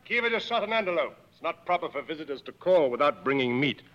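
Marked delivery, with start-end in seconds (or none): 0.0-1.2 calling out. Acted. Calls out to his tracker.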